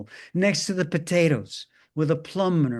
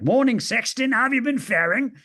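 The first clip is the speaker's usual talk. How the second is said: put-on higher, louder Cockney accent; 7 semitones higher, 4 dB louder than usual. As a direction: fake a cheery Cockney accent. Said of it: "fake a Cockney accent".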